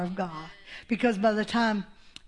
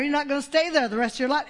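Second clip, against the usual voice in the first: ordinary talk, nothing marked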